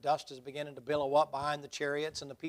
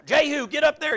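much higher and louder, urging the driver on; mock urging shout; 12 semitones higher, 12 dB louder than usual. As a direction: yell at the chariot driver to speed up. King calls to his chariot driver.

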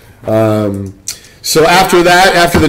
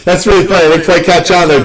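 ordinary talk

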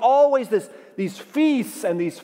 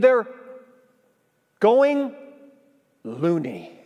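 punchline pun; much slower than usual. Shown as a demonstration. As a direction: deliver loony as a deadpan pun. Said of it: "I like that line".

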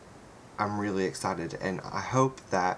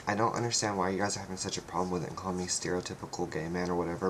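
usual speaking voice

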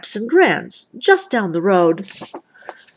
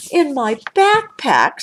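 indignant surprise; 7 semitones higher than usual. A narrator's reading. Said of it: "said Brother".

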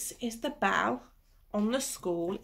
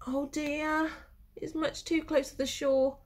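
ordinary delivery

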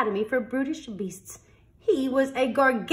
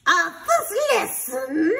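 slow, loud, higher headmaster growl; 9 semitones higher, 7 dB louder, slower than usual. Acted. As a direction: growl it out like a fierce teacher. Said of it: "growled the headmaster".

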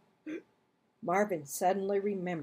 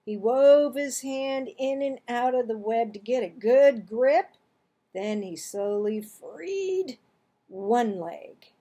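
ordinary delivery

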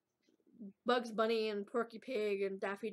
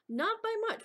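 surprised; 12 semitones higher than usual. Real, not acted. Reacting to revealed search number.

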